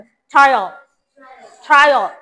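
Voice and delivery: "ট্রায়াল" said as "try-awl", falling; final "awl" sound. Shown as a demonstration. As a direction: model "ট্রায়াল" with a rounded aw ending. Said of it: "লাস্টে অল উচ্চারণ হবে".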